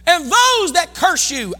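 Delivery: high, loud proclamation; preacher's shout. Acted. Pastor voicing God's promise on curses, loud preaching shout. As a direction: hit it high and loud like a decree.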